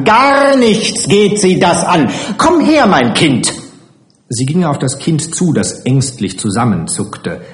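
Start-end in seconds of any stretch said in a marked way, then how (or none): 0.0-3.5 high, furious matron's cry; wütendes Schreien. A narrator's reading. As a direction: shout and command, pitch way up. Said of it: "schrie Frau Adriani". (zornig geschrien, mit hoher Stimme)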